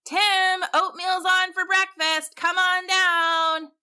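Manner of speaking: a dad calling his son down to breakfast; dad calling upstairs. A narrator's reading. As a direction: call from downstairs, come on down slow. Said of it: "he heard his dad call".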